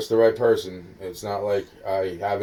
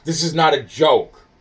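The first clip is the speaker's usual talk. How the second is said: louder and higher, insisting hard; raised voice; 4 semitones higher, 5 dB louder than usual. Real, not acted. Insisting to the listener taking notes, raised voice. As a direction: push it, you need them to take you seriously. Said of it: "Why are you yelling at me?".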